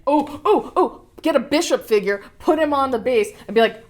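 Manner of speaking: loud, high burst of excitement; excited. Real, not acted. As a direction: blurt out a sudden bright idea. Sudden idea for the figure.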